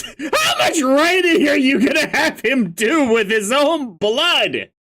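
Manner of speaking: high-pitched laughing disbelief; incredulous. Real, not acted. Reader aghast at writing in blood, high incredulous outcry. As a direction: exclaim through laughter, voice way up.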